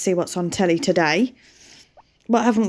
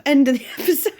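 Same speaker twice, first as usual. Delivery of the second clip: giggling through the sign-off, voice a bit higher; laughing; 11 semitones higher, faster than usual. Real, not acted. Vlogger cracking up while closing the episode, laughing. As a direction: breaks into a laugh mid-phrase, can't hold it together.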